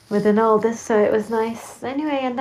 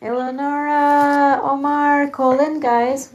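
calling out names; 4 semitones higher, 4 dB louder than usual. Pretend. Calling on guests to phone in.

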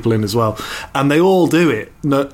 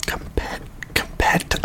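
whispering it, barely voiced; hushed; 9 dB quieter than usual. Real, not acted. Hushed as she dares to compare herself to Kate. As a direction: whisper it like a secret you dare not say. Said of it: "I can't even say it".

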